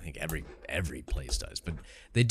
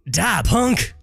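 loud, high-pitched attacking yell; hammed-up action-movie battle cry; battle shout; 12 semitones higher, 8 dB louder than usual. Villain attacks in an acted fight scene, hammed-up shout.

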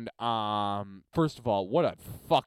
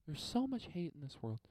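hushed mock sorrow; 11 dB quieter than usual. Real, not acted. Repeated nearly whispered.

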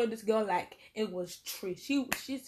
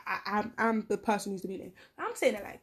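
ordinary talk, nothing marked

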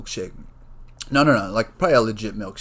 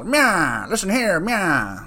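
put-on loud, high old-movie gangster squawk; hammed-up thirties mobster impression; 9 semitones higher, 5 dB louder, much slower than usual. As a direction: bleat it like a cartoon old-time gangster. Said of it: "impression of a thirties gang".

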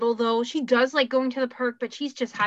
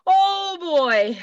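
laughing; 6 semitones higher, 5 dB louder than usual. Real, not acted. Crying with laughter.

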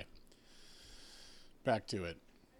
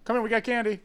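calling out; 10 semitones higher, 4 dB louder than usual. Real, not acted. Calling out to passing kids.

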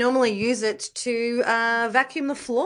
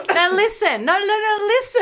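much higher, laughing commands; mock tipsy bossiness; 11 semitones higher than usual. Friend hamming up a tipsy woman demanding attention. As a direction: boss the room like a merry drunk. Mocking drunk confidence.

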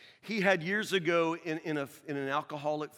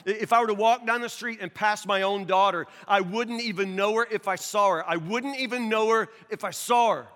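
anguished plea; 7 semitones higher, 10 dB louder than usual. Retold or imitated. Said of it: "pouring his heart out to the pastor".

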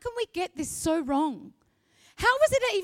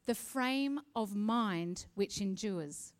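slow emphatic definition; 5 semitones lower, 7 dB quieter, slower than usual. Shown as a demonstration. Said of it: "perseverance means this".

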